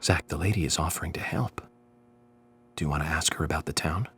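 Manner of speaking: low, hushed aside; confidential aside. Acted. Guest privately consulting Zach about the lady's offer, hushed aside.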